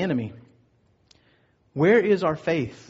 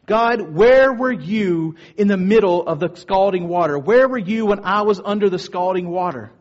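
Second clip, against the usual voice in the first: crying out at God; 3 semitones higher, 5 dB louder than usual. Retold or imitated. Woman confronts God over her childhood burns, crying out. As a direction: demand an answer, voice raised, hitting the question hard. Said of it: "she screamed out at God".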